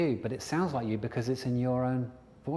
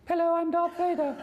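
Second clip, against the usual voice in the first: comically high, squeaky villain; Darth Vader; 5 dB louder than usual. Acted. Coach spoofs a Star Wars villain, high and squeaky. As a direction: squeak the menace away. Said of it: "Darth Vader's voice was a little bit different".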